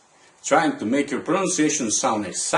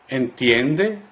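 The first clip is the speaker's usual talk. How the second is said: "Entiende" rising at the end; question intonation. Shown as a demonstration. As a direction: ask "Entiende", lifting the last syllable. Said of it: "using question intonation".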